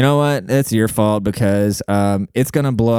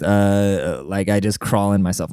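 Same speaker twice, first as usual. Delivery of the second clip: ordinary delivery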